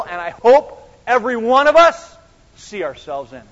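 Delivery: loud, driving sermon delivery; full-force preaching. Acted. Pastor drives the point home to the congregation, loud preaching. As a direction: preach it hard to the room.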